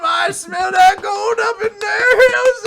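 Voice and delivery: put-on hollering old gold miner; old prospector. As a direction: yell it like a crazed prospector. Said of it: "gold rush character you're playing".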